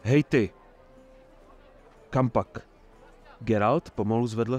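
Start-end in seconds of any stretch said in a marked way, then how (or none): 0.0-2.7 sharp loud challenge to a stranger; calling out. Acted. Guard halts Geralt at the mayor's stall, loud call. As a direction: stop him with a loud call.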